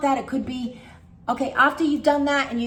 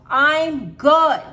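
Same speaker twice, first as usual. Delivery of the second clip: loud "I'm good" shout; yell; 4 semitones higher, 10 dB louder than usual. Shown as a demonstration. Coach showing how to yell at yourself, loud. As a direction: bark it at the thought. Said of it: "You have to yell at yourself".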